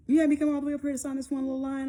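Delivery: fast, high, loud griping imitation; mock complaint. Boyfriend griping about trip to sign, mock-indignant. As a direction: whine it as the put-upon boyfriend.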